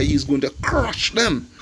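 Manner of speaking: a long, grinding crush to land the point; stress on crush. Real, not acted. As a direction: press hard and long on the word crush. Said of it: "I emphasize on that word crush".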